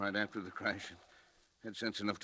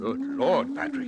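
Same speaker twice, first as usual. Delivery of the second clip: much higher, louder, stunned; awestruck; 8 dB louder, slower than usual. Acted. Colleague seeing the brain respond, stunned outburst. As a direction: blurt it out in amazement.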